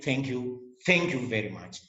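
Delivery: ordinary talk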